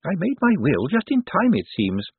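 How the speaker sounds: put-on high-pitched old lady voice; Topsy Lavish. A narrator's reading. Dead woman excuses herself to Death, high and pert.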